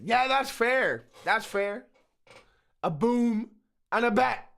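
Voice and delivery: normal talking voice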